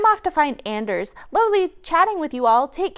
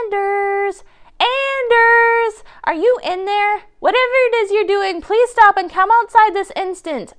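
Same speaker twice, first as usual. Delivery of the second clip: calling out; 6 semitones higher, 5 dB louder than usual. Acted. Calling for friend outside house.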